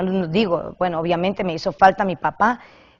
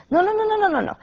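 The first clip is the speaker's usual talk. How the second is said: high, rapid string of nos; emphatic refusal; 13 semitones higher, faster than usual. Real, not acted. Preacher refusing to watch indecent films, emphatic.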